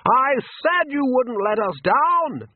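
high, slow calling voice; drawn-out, high-pitched hail; Ridcully, shouting. A narrator's reading. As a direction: call out heartily across the water. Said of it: "shouted Ridcully across the water".

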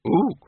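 short, high hoot; instant ape ooh. Acted. The librarian answers Windle instantly, quick high hoot. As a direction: fire back a quick ape noise. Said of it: "said the librarian instantly".

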